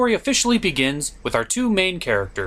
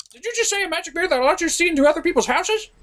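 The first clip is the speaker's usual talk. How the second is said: high, rushed, far faster; eager excited; 12 semitones higher, much faster than usual. Acted. A sidekick blurting to the reviewer about the magic mirror, high and rushed. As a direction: blurt it out greedily.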